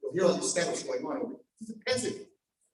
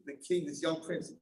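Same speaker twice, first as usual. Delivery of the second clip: normal talking voice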